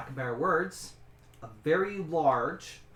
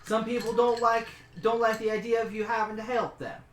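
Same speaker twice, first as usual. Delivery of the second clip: high, loud, stubborn cook; 5 semitones higher, 4 dB louder than usual. Acted. The cook argues with the adventurer about the rooms, high and insistent.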